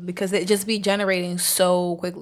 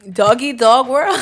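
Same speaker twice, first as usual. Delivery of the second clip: put-on loud, higher, laughing through a Brooklyn accent; 6 semitones higher, 13 dB louder, slower than usual. Laughing while repeating her Brooklyn phrase. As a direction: break up laughing mid-accent. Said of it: "I have a little Brooklyn accent".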